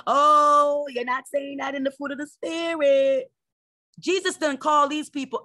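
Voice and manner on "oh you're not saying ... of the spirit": higher, louder prissy mock voice; mocking critics. Preacher mimicking churchgoers scolding her passion. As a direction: whine it like a self-righteous critic. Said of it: "they will attack you".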